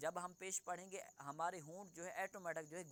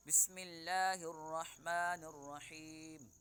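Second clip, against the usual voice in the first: recitation; 5 dB louder than usual. Acted. Opens the lesson with recitation.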